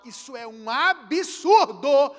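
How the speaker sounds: loud, slow emphatic declaration. Real, not acted. Preacher condemning silent people, loud and slow. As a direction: hammer home that it is absurd. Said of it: "preacher emphasizes".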